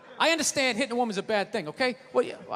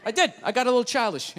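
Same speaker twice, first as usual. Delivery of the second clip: ordinary talk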